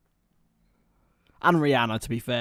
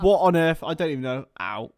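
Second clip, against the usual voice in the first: normal talking voice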